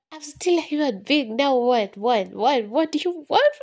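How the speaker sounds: acted loud, high comeback at an inner voice; exasperated outburst. Arguing back at the cruel inner voice, exasperated.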